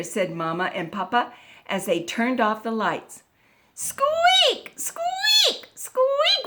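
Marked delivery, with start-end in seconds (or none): 3.7-6.5 high-pitched drawn-out bed squeaks; squeaky bed. A narrator's reading. Storyteller voices the creaky bed for listeners, high squeaks. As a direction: squeal each squeak up high like old springs. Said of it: "went the bed".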